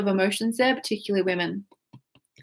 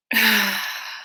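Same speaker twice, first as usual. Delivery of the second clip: long audible exhale; releasing sigh. Real, not acted. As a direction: let it all go in one sigh. Breathing exercise, letting go.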